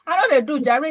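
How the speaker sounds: normal talking voice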